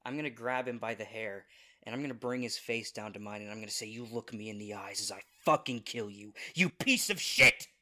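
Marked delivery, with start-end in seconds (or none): 3.9-7.7 vengeful fury. Acted. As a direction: start controlled, then explode on the insult. Player's character kills enemy face to face.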